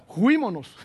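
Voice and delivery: a light Cantinflas juímonos; lightly voiced Cantinflas slang. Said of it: "como dijo Cantinflas".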